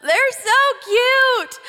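drawn-out high gush of adoration. Real, not acted. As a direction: melt over how cute they are. Mom gushing about her daughters.

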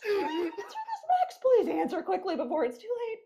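high, squeaky laughing urgency; laughing plea. Real, not acted. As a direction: beg through helpless laughter. Pleading while laughing after bad accent.

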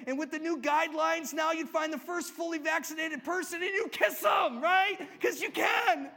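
ecstatic, laughing yell; excited. Pretend. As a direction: shout it like you just got set free. Imagining joy of forgiven debt.

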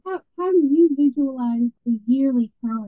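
muffled, like talking through water; garbled bad microphone sound; underwater. Real, not acted. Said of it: "You sound like you're underwater".

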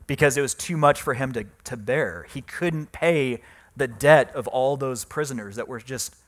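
ordinary talk, nothing marked

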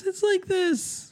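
very high, slow put-on girl; little girl voice. Shown as a demonstration. Dad demonstrating his one girl voice, little girl falsetto. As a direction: squeak like a small girl. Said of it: "girl voice sound like".

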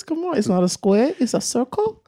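high, rising quote of a baffled question; movie quote. Retold or imitated. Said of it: "Chuck and Larry reference".

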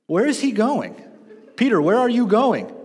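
loud, high call after someone leaving; calling out to Peter. Acted. Preacher calls to Peter jumping out of the boat, loud and high. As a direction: shout after him as he climbs out.

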